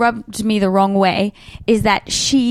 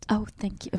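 whisper; 13 dB quieter than usual. Real, not acted. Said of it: "your beautiful accent".

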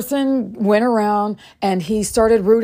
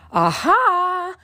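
high, knowing "aha"; acted-out leading agreement; pointed agreement; 9 semitones higher than usual. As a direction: pitch it up like he has finally got it.

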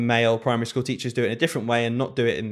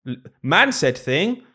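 slow, higher and louder, dumbed-down caveman talk; mock simple-minded thinking; 5 semitones higher, 4 dB louder, slower than usual. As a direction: spell it out like a simple brain weighing who spoke. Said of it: "I don't think people consciously ever say".

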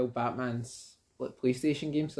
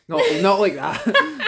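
1 laughing denial; 4 semitones higher, 7 dB louder, much slower than usual. Real, not acted. Laughing at his own double meaning.

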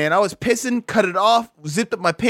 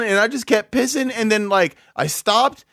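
ordinary talk

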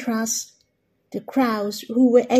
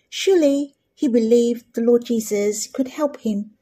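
ordinary delivery

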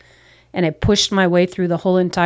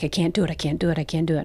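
panicked murmur; 2 semitones lower, 8 dB quieter, faster than usual. Retold or imitated. Panicking in waterfall tunnel.

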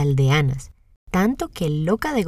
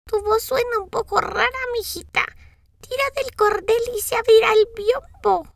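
anciana que grita desde la cama, aguda; llama alto desde la cama; voz de abuela gritando. A narrator's reading. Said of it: "contestó gritando". (abuela gritando)

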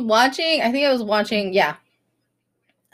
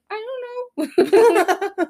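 put-on high, innocent child voice; pitched-up kid voice; little kid; 11 semitones higher, much slower than usual. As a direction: imitate a child's sheepish answer. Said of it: "he's like".

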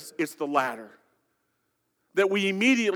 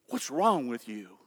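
softer, lower, turned inward; quiet musing; 9 semitones lower, 8 dB quieter than usual. Shown as a demonstration. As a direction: say it to yourself, wondering. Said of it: "pastor muses on the question alone".

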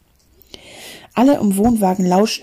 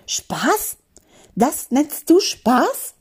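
whispered then slow rising outrage; slow indignant rising question; furious disbelief; 6 semitones higher, much slower than usual. A narrator's reading. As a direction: hiss the first word, then stretch out the question. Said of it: "Wütend hielt Mathilda".

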